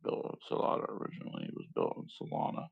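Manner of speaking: quick whisper. Real, not acted. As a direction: breathe it out fast. Continues whispered reading.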